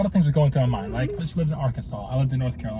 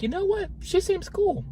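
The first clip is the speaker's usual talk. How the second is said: high quoted thought; slower than usual. Retold or imitated. Quoting his own past thought, high.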